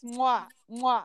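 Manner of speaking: two smacking kiss sounds; double air kiss. Pretend. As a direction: blow two quick kisses. Blowing kisses to a brand.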